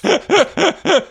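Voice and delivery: loud high-pitched laugh; high burst of laughter. Real, not acted. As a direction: break into a squealing laugh.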